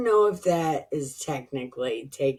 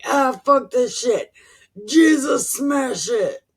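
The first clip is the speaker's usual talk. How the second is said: high, loud hammed-up "Jesus smash it"; mock frustrated outburst; 8 semitones higher, 6 dB louder than usual. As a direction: blurt it out like you've had enough.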